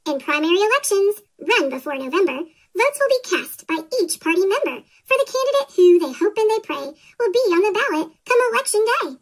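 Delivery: ordinary delivery, nothing marked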